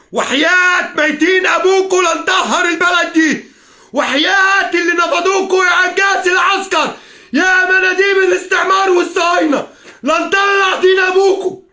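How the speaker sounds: high, drawn-out roar of rage; real screaming outrage; furious shouting. Real, not acted. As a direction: curse them at full voice, stretching each phrase. Cursing the regime in rage.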